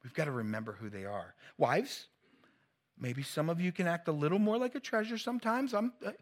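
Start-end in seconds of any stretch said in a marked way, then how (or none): none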